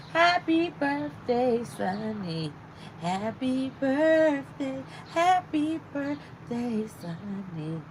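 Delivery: slow, stretched happy birthday; slow drawn birthday. Real, not acted. Streamer to viewer Sonny, stretching out the greeting slowly. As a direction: draw each word out slowly.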